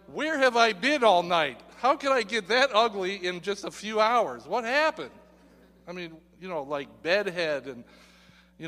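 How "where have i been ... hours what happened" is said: mock dismay. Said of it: "It's like".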